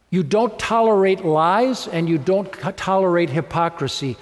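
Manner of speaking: ordinary delivery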